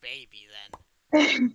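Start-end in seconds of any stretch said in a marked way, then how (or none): 1.1-1.5 shout. Acted. Yelling big baby at friend.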